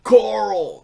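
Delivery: loud, high-pitched Southern holler; Rick Grimes. Acted. Quoting a TV character to friends, loud Southern holler. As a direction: call out in a hammed-up Southern drawl. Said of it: "Andrew Lincoln in The Walking Dead does Rick Grimes".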